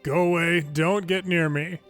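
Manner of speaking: normal talking voice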